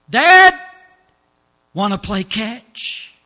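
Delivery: high, loud call then slow plea; calling out to Dad. Acted. Ray calling out to his father in the film, loud pleading call. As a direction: cry out to a long-lost father.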